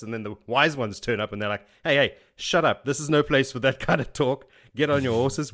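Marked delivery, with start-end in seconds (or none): 1.8-5.5 louder, higher retold telling-off; mock scolding. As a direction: cut in bossily, acting out a scolding elder. Acts out wise ones scolding the men.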